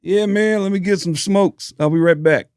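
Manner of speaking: high, easygoing mock Chappelle; imitating Chappelle. Dave Chappelle retold.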